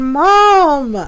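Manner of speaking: loud, high, drawn-out cry of disbelief; mock-appalled long call to mother; retold shocked protest. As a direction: exclaim it like an embarrassed daughter.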